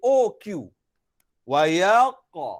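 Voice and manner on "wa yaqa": slow "Wa yaqa", lips rounded before q; pouted o sound. Shown as a demonstration. Teacher shows pursed lips, "Wa yaqa" slow and rounded. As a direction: pout into ya-o on "Wa yaqa". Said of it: "Bibir saya moncong sikit".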